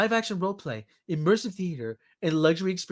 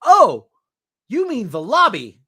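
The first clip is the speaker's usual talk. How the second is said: mock realization; 4 semitones higher, 9 dB louder than usual. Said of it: "And I said".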